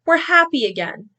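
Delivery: high cheerful goat voice; happy goat. Acted. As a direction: bleat it joyfully. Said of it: "bleated Goat".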